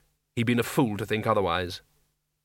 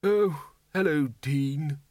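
unhappy; much slower than usual. Acted. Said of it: "he said unhappily".

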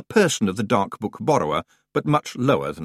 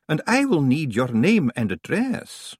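a lightly voiced prim young librarian; Miss Jenkins; 6 semitones higher than usual. A narrator's reading. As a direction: answer crisply and politely.

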